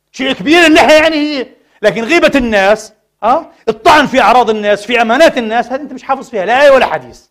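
preacher scolds the backbiting congregation, loud and fierce; loud rebuke. Real, not acted. Preacher rebukes hypocrisy loudly.

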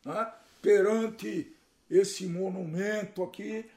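slow, mock-ceremonial vow; tongue-in-cheek formal declaration, taken slowly; ironic solemnity. Real, not acted. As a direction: make a drawn-out, pompous pledge with irony. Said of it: "Ele tá sendo irônico aqui".

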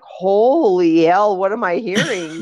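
slow, loud holy hell then laughing; amazed slow exclamation; stunned amazement. Retold or imitated. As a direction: drag out holy hell in disbelief. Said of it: "I was like".

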